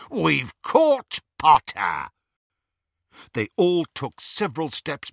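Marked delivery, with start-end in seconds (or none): 0.1-2.1 louder, slow and gloating; triumphant. A narrator's reading. Greyback to the snatchers, slow loud triumph. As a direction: announce the catch in triumph.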